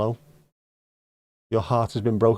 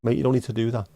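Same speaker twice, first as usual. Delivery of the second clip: usual speaking voice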